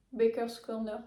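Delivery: Baker's Corner with a light English accent, bekœrs kɔrnœ. Shown as a demonstration. Knitting vlogger naming the yarn colour, light English accent. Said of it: "c'est pas avec mon accent anglais".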